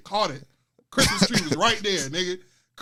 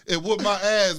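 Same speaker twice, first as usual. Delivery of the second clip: usual speaking voice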